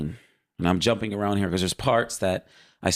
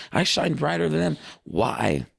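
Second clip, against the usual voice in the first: proud Lucifer; 8 semitones higher than usual. Retold or imitated. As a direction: boast like a vain angel admiring himself. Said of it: "He would say those things".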